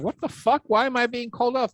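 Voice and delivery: high-pitched mock disbelief; acted bewilderment. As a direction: play a baffled agent protesting, voice jumping way up. Acting out baffled Secret Service agents.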